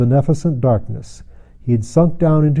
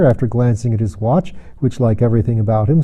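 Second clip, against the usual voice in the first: usual speaking voice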